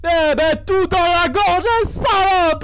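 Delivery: cri rageur, aigu et fort; furieux. Real, not acted. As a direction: hurle l'insulte avec rage. Rage contre un personnage horrible. (cri de rage aigu)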